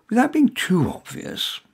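plain reading voice

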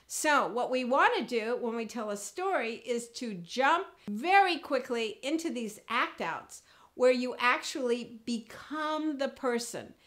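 ordinary talk

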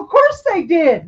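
ordinary talk, nothing marked

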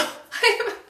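short high-pitched laugh; a quick bright giggle. Real, not acted. Laughs at reaching on tiptoes.

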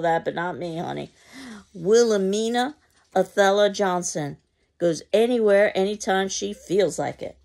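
high, loud, hammed-up bravado; insincere melodrama. Mrs. Johnson bragging to Mama, loud insincere melodrama. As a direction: oversell it, melodramatic and fake. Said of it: "tremendous and rather insincere sense of melodrama".

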